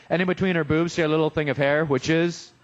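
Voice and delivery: ordinary talk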